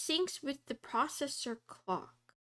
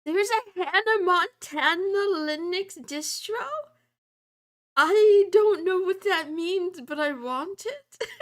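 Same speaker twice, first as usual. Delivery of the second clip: much louder and higher, thrilled; excited outburst; 7 semitones higher, 11 dB louder than usual. Real, not acted. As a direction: blurt it out, delighted. Excited by Linux distro news.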